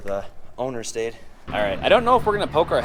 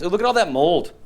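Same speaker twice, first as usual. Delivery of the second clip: usual speaking voice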